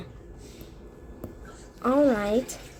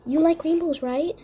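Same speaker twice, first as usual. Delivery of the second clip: normal talking voice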